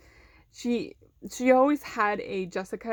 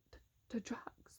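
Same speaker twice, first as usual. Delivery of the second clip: mimicking a hushed, voiceless question; whisper; 18 dB quieter than usual. Student echoing the vice principal's suggestion, whispered. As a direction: drop to a puzzled mock whisper. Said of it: "why are we whispering?".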